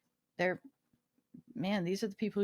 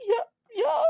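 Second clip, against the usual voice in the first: nearly in tears; 13 semitones higher than usual. Retold or imitated. Nurse covers her mouth at a patient's request, imitated tearful squeak. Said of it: "she's almost in tears".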